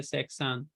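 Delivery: सेक्सान said quietly with a long a at the end; teacher modelling the correct सेक्सान; correct seksan. Shown as a demonstration. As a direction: lengthen the last vowel of सेक्सान. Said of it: "आ के करीब वाली आवाज़ आती है".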